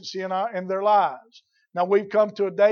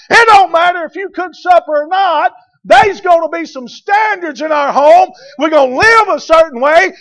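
shouted, much higher and louder sermon climax; full-force preaching; 8 semitones higher, 12 dB louder than usual. Real, not acted. Preacher voicing a daddy laying down the law, shouting. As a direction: hammer it home at the top of your voice.

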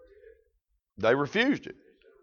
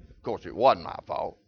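ordinary delivery

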